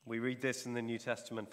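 ordinary talk

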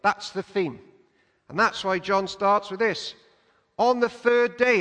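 ordinary delivery

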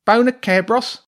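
very high, loud rat voice; rat soldier. A narrator's reading. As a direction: squawk a puzzled question as a rat. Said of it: "said one of the nearest ones".

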